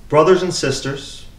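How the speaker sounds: measured, solemn lectern reading; scripture reading. Acted. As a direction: read it out as the lesson at Mass.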